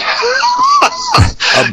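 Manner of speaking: very high-pitched real laugh. Real, not acted. Storyteller cracks up before telling the Harrison tale, laughing.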